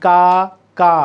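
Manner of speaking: কা, কা said as two separate beats; teacher sounding out each syllable. Shown as a demonstration. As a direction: split কাকা into কা and কা. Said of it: "দুইবার উচ্চারণ করি".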